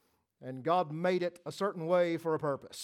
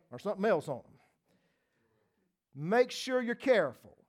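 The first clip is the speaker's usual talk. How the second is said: ordinary talk, nothing marked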